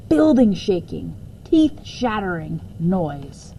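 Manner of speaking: plain reading voice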